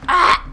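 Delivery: sharp surprised shout; startled yelp. Real, not acted. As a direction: yelp as if hit. Sudden hit in firefight.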